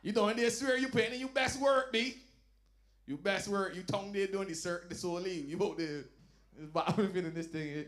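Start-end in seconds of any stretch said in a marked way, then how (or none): 0.0-2.2 mock loud praise. Mocking a partner mid-act.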